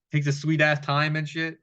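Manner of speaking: ordinary talk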